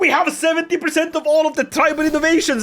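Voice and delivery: high, loud cheering; victory shout. Real, not acted. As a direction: celebrate the win loudly. Celebrating game milestone.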